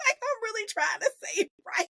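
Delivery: high-pitched, laughing through the words. Real, not acted. Fan admitting she can't pronounce the actor's name, laughing. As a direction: protest through helpless giggles. Giggling at own failed pronunciation.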